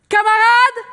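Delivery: high, very loud call across a hall; rallying shout. Acted. Worker-priest calls to the roaring assembly, rallying shout. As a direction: holler it like a strike leader. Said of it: "tout le monde crie".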